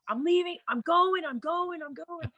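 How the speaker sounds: high, repeated protest, fading at the end; mock frantic. Sister escaping her screaming brother, frantic.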